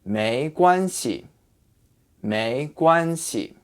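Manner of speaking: 没关系 slow and careful, 系 toneless; slow model phrase. Shown as a demonstration. Teacher models the whole phrase, slowly. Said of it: "say this with a neutral tone".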